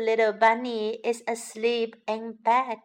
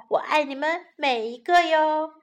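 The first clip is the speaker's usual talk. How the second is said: ordinary delivery, nothing marked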